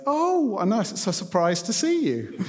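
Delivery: playful feigned surprise at the gate; mock surprise. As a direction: act out a staged chance encounter. Pretends chance meeting after running.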